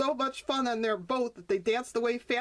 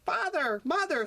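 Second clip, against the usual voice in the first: calling out; 7 semitones higher than usual. Acted. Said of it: "Skip cried out".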